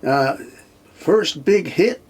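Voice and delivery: Barbadian DJ accent. Quoting the Barbados radio DJ introducing the song, mock Barbadian accent. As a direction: ham up a Barbadian radio DJ announcing a hit, slowly. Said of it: "Can't do the accent".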